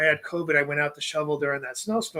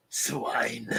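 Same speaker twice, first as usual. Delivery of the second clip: whispered aside; 5 semitones lower, 6 dB quieter than usual. Pretend. Whispered aside after reading.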